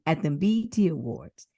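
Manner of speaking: usual speaking voice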